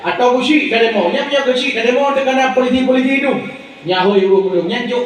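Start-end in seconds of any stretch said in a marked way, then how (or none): none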